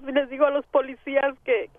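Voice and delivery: usual speaking voice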